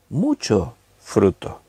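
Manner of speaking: "mucho fruto" slowly, weight on "mucho"; stress on MUCHO. Shown as a demonstration. Preacher asks listeners to note the amount, leaning slowly on "mucho". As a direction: draw out "mucho" so listeners notice it.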